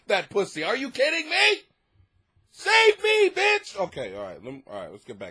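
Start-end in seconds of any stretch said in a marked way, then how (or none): 0.6-3.8 high, loud hammed-up plea; mock desperate shout. To the basement people, a hammed-up high shout. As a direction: yell it like begging to be rescued.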